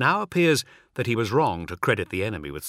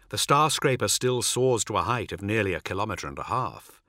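ordinary delivery

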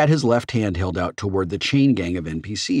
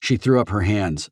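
usual speaking voice

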